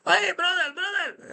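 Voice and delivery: high, pushy imitated Colombian hustler accent; street hustler accent. Street hustler hailing the speaker, imitated Colombian accent. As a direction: call out like a street seller in a put-on accent. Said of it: "He's like".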